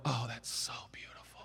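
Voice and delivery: soft, breathy gush of wonder; mock awed whisper. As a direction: whisper it like a dewy-eyed churchgoer. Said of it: "And we all go".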